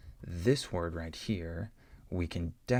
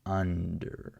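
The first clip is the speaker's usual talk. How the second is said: "under" with a full, louder UN; stress on first syllable; 4 dB louder than usual. Shown as a demonstration. Teacher models a stressed short U for learners, first syllable stressed in "under". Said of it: "I'm consciously making that short U".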